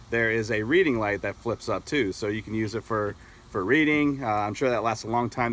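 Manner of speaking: ordinary talk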